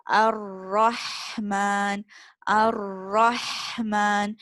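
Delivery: "Ar-Rahman" very slow, air pushed through the middle throat; breathy Ha. Shown as a demonstration. Teacher modelling the middle throat Ha for students. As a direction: stretch "Ar-Rahman" with a long airy Ha. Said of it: "feel the air in your middle throat".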